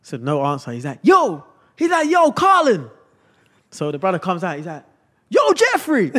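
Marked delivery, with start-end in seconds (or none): 1.0-2.9 high, loud call of Yo, Carlin. Retold or imitated. Uncle calling the shop worker, loud call. 5.3-6.1 high loud call of Yo, Jeffrey. Retold or imitated. Worker calling his brother, loud call.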